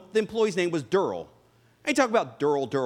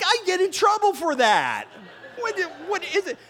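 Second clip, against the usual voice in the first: laughing disbelief; 8 semitones higher than usual. Retold or imitated. Shocked at grandma in fridge.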